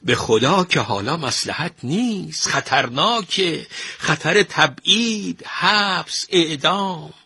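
high-pitched, urgent plea, slowing on each danger; alarmed interruption. A narrator's reading. Minister cuts in to warn the painter, alarmed. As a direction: warn him of mounting dangers. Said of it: "پرید توی حرف نقاش و گفت".

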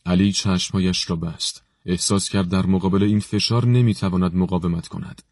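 ordinary delivery, nothing marked

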